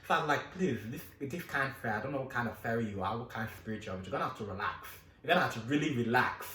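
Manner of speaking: soothing plea. Retold or imitated. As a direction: gently talk a spirit into relaxing. Calming the fairy softly.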